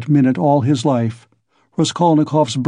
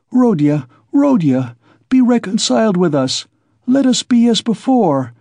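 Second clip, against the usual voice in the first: high, imploring appeal; pleading cry; 8 semitones higher than usual. A narrator's reading. Poor mother begging her son to reconcile, crying out. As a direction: plead in a raised pitch, without shouting. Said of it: "cried his poor mother".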